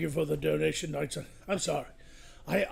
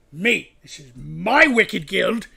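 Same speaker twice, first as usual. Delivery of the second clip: booming villain proclamation; 4 semitones higher, 16 dB louder, slower than usual. Acted. Villain lord boasting to his guild.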